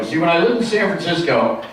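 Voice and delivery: ordinary talk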